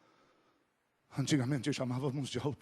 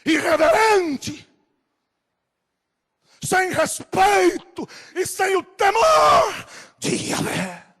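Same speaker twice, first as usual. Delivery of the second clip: high, very loud drawn-out shouts; outraged rebuke; 13 dB louder, slower than usual. Real, not acted. Preacher denouncing those who take calls in church, furious shouting. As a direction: thunder each reproach slowly from the pulpit.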